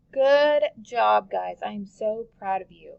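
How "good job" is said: ordinary talk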